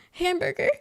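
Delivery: high, loud questioning "Hamburger?"; confused repeat order. Retold or imitated. Repeats the order, not understanding reply.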